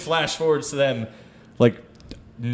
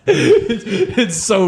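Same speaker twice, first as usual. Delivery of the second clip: laughing; 10 semitones higher, much slower than usual. Real, not acted. Laughing at own retelling.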